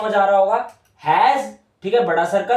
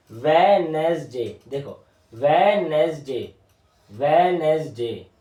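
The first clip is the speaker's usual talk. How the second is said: 1 slow drawn-out word; 2 semitones lower, much slower than usual. Shown as a demonstration. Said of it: "teacher drawing and saying word".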